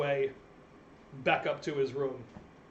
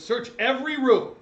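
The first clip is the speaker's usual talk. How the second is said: a commander ordering his men to search the inn; guard commander's order; 4 dB louder than usual. Acted. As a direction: bark the order to the troops. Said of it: "the commander of the guard goes".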